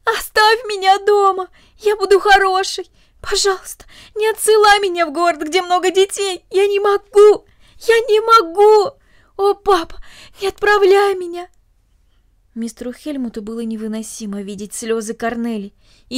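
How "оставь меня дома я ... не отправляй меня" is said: high, loud, tearful begging; sobbing plea. A narrator's reading. Cornelli pleads with papa to keep her home, sobbing loudly. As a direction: beg through sobs, voice cracking. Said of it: "разразилась бурными рыданиями".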